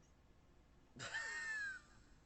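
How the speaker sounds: high burst of shrill laughter; laughing at how ridiculous it was; shrieking laugh. Real, not acted.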